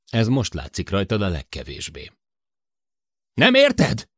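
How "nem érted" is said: loud, high furious yell; loud desperate shout. A narrator's reading. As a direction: scream it at her in frustration. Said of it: "Sid ordított".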